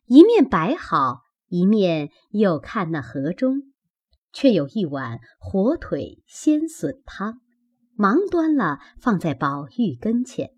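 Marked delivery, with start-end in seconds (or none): none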